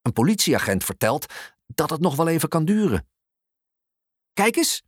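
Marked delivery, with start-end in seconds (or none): 4.3-4.8 put-on high, excited little boy; enthusiastic call. A narrator's reading. Boy showing mum a toy crane, enthusiastic. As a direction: call out with eager delight, pitched up. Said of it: "roept Leroy enthousiast".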